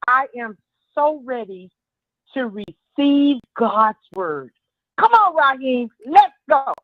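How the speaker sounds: ordinary talk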